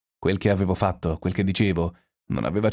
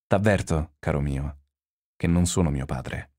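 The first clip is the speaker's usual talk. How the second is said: understated, weary gravity, sinking lower; anguished weariness; 3 semitones lower than usual. A narrator's reading. Warning his associate with a hand on his shoulder, wearily. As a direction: let it sag slowly downward with tired seriousness. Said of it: "aggravata ad un'angosciosa stanchezza".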